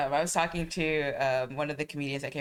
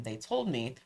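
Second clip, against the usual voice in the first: ordinary delivery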